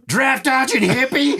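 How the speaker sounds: high, strained mock outburst; imitating angry heckler. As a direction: blurt it like an outraged curmudgeon. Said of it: "this guy in my head".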